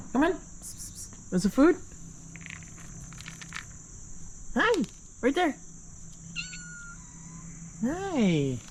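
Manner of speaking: high, soft coaxing; pet-talk coo. Real, not acted. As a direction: coax the shy pets gently. Coaxing pets.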